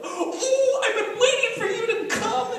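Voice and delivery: high, excited gushing welcome with a laugh; excited grandma greeting. Retold or imitated. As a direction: gush like a delighted grandma at the airport. Grandma greeting family at airport.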